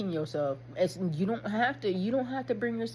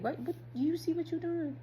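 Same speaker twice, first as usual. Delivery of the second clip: mock self-check; 9 semitones higher, 3 dB quieter than usual. Checking himself in self-talk.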